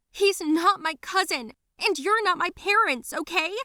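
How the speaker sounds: high, loud defiant retort; angry yelling back. Acted. Girl disowning cousin and parents, angry yell. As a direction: shout them down, pitched right up.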